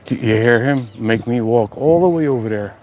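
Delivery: usual speaking voice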